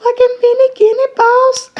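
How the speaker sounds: very high, loud, crying with joy; ecstatic shouting. Real, not acted. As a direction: cheer like a parent at first steps. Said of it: "that was exciting".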